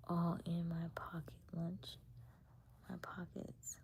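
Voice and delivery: slow, hushed complaint; whispered. Real, not acted. Asking why he is all in her pockets, slow whisper. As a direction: mutter it under your breath.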